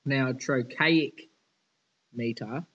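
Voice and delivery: usual speaking voice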